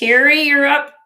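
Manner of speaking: called out loud and high to the next buyer; calling out. Real, not acted. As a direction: announce her turn, loud and bright. Calling next customer on stream.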